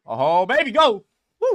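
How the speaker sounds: loud, high whoop of joy; excited cheering. Real, not acted. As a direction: cheer the trade on at full voice. Cheering a winning trade.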